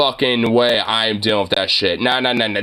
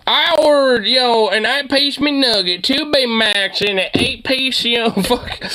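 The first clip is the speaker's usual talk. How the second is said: higher, slow mock rant of a demanding wife; 9 semitones higher, slower than usual. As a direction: ham up the wife's angry order. Mimicking the wife's rant.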